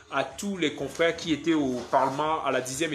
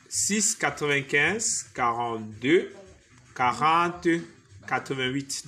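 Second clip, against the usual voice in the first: slow dictation; slower than usual. Shown as a demonstration. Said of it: "je mets en commentaire".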